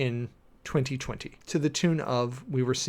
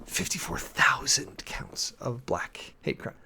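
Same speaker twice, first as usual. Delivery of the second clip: whisper; 5 dB quieter, slower than usual. Real, not acted. Reading a grim statistic.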